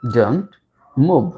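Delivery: "Don't", long pause, "move"; teacher spacing out "Don't move"; word by word. Shown as a demonstration. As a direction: separate "Don't" and "move" for learners.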